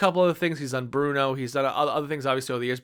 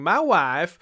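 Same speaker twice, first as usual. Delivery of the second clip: a lightly voiced Borat accent, a touch louder; Borat quote; 3 semitones higher, 5 dB louder than usual. Quoting Borat to viewers, a light Borat accent. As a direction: clip the words the way Borat says them. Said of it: "without hearing".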